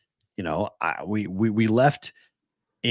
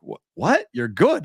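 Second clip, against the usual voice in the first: high, incredulous questioning; retold disbelief; 5 semitones higher, slower than usual. Co-host reacting to friend after the stroke, incredulous. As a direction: replay being floored by the news. Acts out own disbelief at news.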